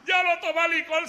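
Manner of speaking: high scornful laugh running into the words; mocking laughter. Old friend laughs at the preacher's new sobriety, high and scornful. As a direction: laugh him down. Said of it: "se reía de mí".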